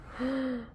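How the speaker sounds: quick frightened intake of breath; sharp nervous gasp. Real, not acted. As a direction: gasp before the risky step. Scared before pressing.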